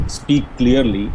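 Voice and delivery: ordinary talk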